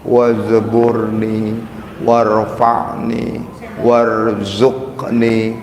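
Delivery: each word drawn out slowly and felt; slow heartfelt recitation. Shown as a demonstration. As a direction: savour every word of the prayer. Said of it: "Rasakan bacaan kalimat itu".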